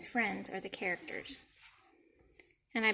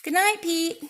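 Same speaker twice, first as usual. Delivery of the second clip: higher, louder call of good night; 7 semitones higher, 8 dB louder than usual. Acted. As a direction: call good night across the dark room.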